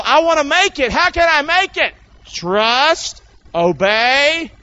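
high, loud revival preaching; fiery call-and-response preaching; preaching at full force. Acted. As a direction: drive it home to the congregation.

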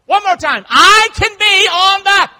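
high shouted rallying cry; loud, high preacher urging them to join in; rousing call-and-response preaching. Real, not acted. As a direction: lead the crowd at full volume. Leads congregation in chant.

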